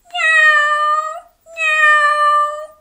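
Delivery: very high, loud yowling meow; cat meow. A narrator's reading. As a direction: yowl like cats on a wall. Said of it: "sang the cats on the wall".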